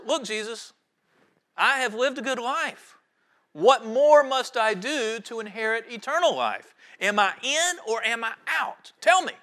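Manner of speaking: high-pitched, mildly acted pressing for an answer; insistent demand. Rich man to Jesus about eternal life, acted insistent demand. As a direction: press for a verdict, building to the last words.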